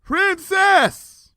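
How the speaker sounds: high calling shout; long high shout across a distance. A narrator's reading. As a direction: call her from the tower top. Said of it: "I shouted down".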